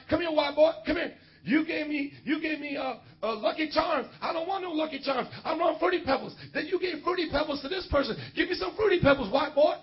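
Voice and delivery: high, loud mocking demand from a project resident.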